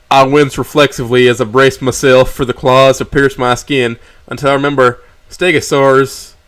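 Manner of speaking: usual speaking voice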